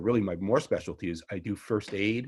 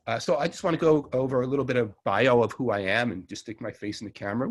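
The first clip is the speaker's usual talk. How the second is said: ordinary talk, nothing marked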